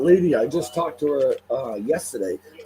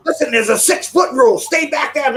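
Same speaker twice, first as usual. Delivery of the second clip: higher, louder mock of her bossy warning; hammed-up impression of the woman's warning; mimicking the woman; 9 semitones higher, 5 dB louder than usual. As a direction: imitate her ordering you back, a bit shrill and loud. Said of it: "she goes".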